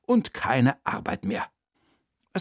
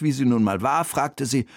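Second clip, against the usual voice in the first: ordinary delivery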